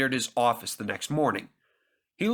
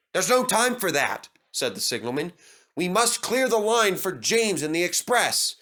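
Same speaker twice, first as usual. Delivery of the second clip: higher, brisk official voice; a hint of a stern signalman; impatient signalman; 6 semitones higher than usual. A narrator's reading. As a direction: brush the driver off.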